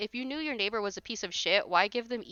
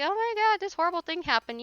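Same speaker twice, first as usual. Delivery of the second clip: mocking whiny pet owner; 10 semitones higher than usual. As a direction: squeal it like an owner who can't believe it. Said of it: "people are always like".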